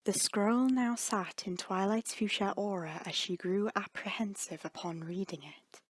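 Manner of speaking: ordinary delivery, nothing marked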